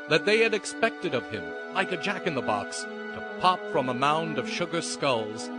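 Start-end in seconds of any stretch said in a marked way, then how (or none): none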